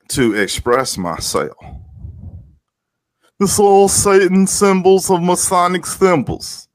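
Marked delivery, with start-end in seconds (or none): 3.4-6.7 very high, sustained put-on voice; hammed-up high-pitched mockery; mocking conspiracy caller. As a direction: mimic an obsessive alarmist in a high monotone. Returns to the mocked Masonic-symbols voice.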